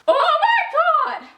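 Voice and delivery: high, loud fangirl cry; shocked excitement. Real, not acted. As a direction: cry out at a huge plot twist. Reacting to the big plot twist.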